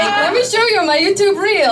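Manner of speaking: playful imitation of a vain guy; mimicking him.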